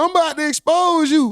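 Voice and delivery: high, loud mocking threat; a high, mocking I'm about to expose you. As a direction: squeal it like an eager snitch.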